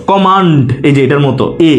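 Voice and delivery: ordinary delivery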